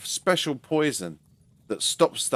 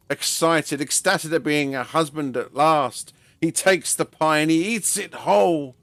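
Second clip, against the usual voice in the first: ordinary delivery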